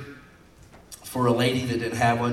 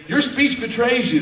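pitched-up accusation; mock accusation in a raised voice; accusing; 10 semitones higher, 4 dB louder than usual. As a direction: call him out, higher than usual. Acted accusation of Peter.

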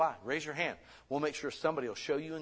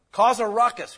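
much higher and louder, a real rallying cry; rousing shout; 10 semitones higher, 14 dB louder than usual. Real, not acted. Preacher rallying the congregation to speak out, rousing shout. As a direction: fire them up.

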